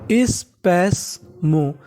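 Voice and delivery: स्पैस्मो stretched very slowly, each syllable apart; very slow sounding out. Shown as a demonstration. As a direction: drag out स्पैस्मो slowly for learners. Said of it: "क्या पढ़ेंगे?". (स्पैस्मो बहुत धीरे-धीरे खींचकर)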